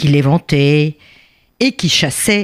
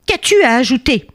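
higher, more insistent question; irritated repeat; 8 semitones higher than usual. Acted. King challenging the cheeky bee, irritated repeat. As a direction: press the question with raised pitch. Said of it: "il s'énerva de nouveau".

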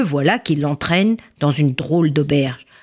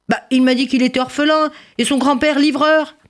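high-pitched disbelief; astonished protest; 9 semitones higher than usual. Acted. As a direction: exclaim as if caught out by a lie. Woman exposes lie, man protests. (étonnement indigné, voix aiguë)

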